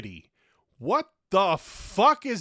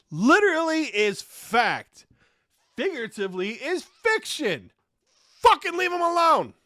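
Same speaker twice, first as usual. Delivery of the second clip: angry rant; 8 semitones higher, 6 dB louder than usual. Real, not acted. Ranting at Oxford Dictionary, loud angry scolding. Ranting about literally's redefinition.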